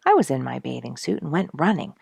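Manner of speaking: usual speaking voice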